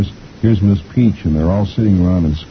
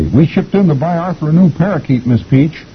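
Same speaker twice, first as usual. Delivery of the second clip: put-on high-pitched kids' voice; schoolkids; 11 semitones higher than usual. A narrator's reading. Kids explain to Miss Peach, high kid voice. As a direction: chirp it up to the teacher. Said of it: "And the kids say".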